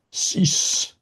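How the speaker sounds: sudden high piercing cry; piercing scream. Acted. Visitor silences Dozzie with a piercing scream. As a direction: cut her off with a scream. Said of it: "His piercing scream".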